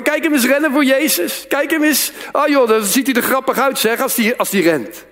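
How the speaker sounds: high-pitched jeering onlookers; mocking. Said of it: "dat mensen je uit gaan lachen".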